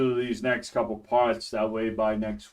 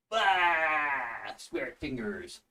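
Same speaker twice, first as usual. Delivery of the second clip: ordinary talk, nothing marked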